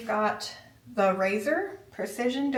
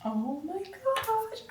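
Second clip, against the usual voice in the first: high, slow gasp of delight; thrilled at the big reveal; excited; 10 semitones higher, much slower than usual. Real, not acted. Finally unboxing the espresso machine.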